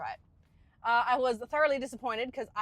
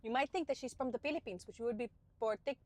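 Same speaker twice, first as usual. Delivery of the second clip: put-on quieter Filipino-accented English; mother's Filipino accent; 5 dB quieter than usual. Showing how mom talks, Filipino accent. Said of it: "she sounds a bit like this".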